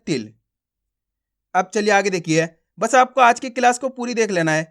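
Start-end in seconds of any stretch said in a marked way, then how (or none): 0.1-0.3 तिल halting right on the l; stop on final consonant. Shown as a demonstration. Teacher corrects the तिला mistake, तिल stopped on ल. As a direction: end तिल on ल with no "aa" after it. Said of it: "ला पा के आपको रुकना है".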